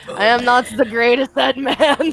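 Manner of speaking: ordinary talk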